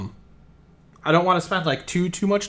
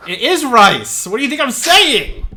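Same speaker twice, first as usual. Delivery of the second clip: high, loud protest at being misheard; mock exasperation; 8 semitones higher, 5 dB louder than usual. Real, not acted. Game master protesting to players over a misheard name, exasperated. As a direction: drag out the words, then rise in disbelief.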